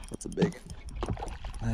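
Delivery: hushed voice so the fish stay calm; fishing whisper. Real, not acted. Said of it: "I'm trying to be quiet".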